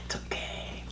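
soft mock-consoling whisper. Husband teasing his teary wife, mock soothing whisper. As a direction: whisper it like comforting a crying child, teasing.

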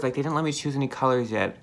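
lip held down by finger; talking with finger at mouth. Real, not acted. Seen 2: Index finger presses lower lip down, showing braces, throughout the words.

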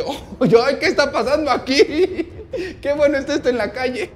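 high, loud squealing with laughter; amused shock. Retold or imitated. The doctor enjoys the fight in the street, amused shock. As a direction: gasp and giggle at juicy drama.

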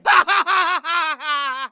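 high soft laugh; nervous laugh. Real, not acted. Laughing at the game's question.

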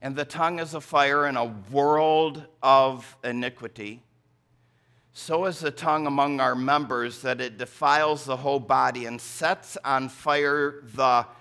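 solemn scripture reading from the pulpit. Acted. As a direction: read the verse with weight. Reads scripture verse aloud.

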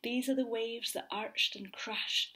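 ordinary delivery, nothing marked

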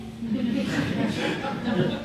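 low distant evil chuckle; quiet villain laugh. Acted. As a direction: laugh like a retreating monster. Monster laugh.

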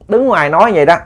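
usual speaking voice

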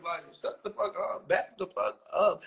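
ordinary talk, nothing marked

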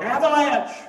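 high, loud shout of alarm; yelled warning. Acted. Man facing firing squad cries out to distract the shooters, yelled warning. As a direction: holler it out as a sudden warning. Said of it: "he yells out".

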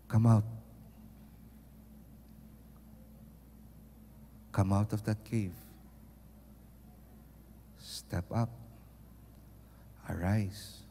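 soft whispered call in the heart; still small voice. Shown as a demonstration. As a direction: breathe it like a gentle inner voice. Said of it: "that still small voice".

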